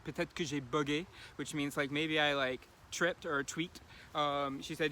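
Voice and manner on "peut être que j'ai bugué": quoting the trainer in natural French; French quote. Retold or imitated. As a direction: say it as she said it, in French. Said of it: "I guess is a good translation".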